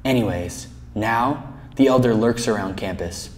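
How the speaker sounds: low, hushed storyteller; legend storytelling. Acted. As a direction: tell it like a campus legend, soft and low. Resumes the legend.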